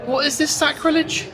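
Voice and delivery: high exasperated mock indignation; mock outrage. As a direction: play it up as outrageous. Mock outrage at shop numbers.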